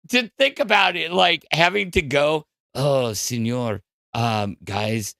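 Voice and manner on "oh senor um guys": put-on a lower, quieter stab at a Spanish accent that keeps falling apart; fumbling a bad Spanish accent, lower and softer; failed Spanish accent. Vlogger imitating the matador's doctors, then giving up: botched Spanish accent. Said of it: "I can't do a Spanish accent".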